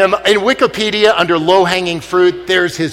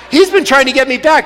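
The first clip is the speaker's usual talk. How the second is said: ordinary delivery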